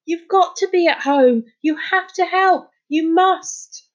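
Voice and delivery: high-pitched, insistent begging; urgent plea. A narrator's reading. Boy begging an absent person for help, urgent. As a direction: plead with rising desperation. Desperate plea after the shout.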